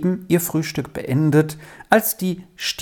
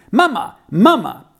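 put-on high, louder, boyish eager calling; 7 semitones higher, 4 dB louder than usual. Boy runs in calling his mother, eager and loud. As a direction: call out to mother, bursting with news. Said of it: "rief er eifrig".

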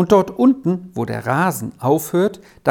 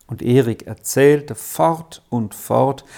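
usual speaking voice

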